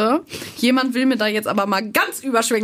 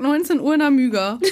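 ordinary talk

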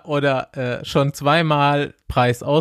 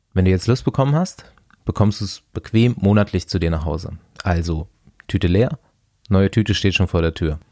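lower, polished sponsor read; 6 semitones lower than usual. Acted. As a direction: read the ad smoothly.

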